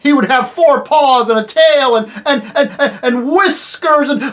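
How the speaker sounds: loud, high guffaws breaking through the words; laughing. A narrator's reading. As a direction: laugh uproariously while trying to keep talking. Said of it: "Bree began to laugh".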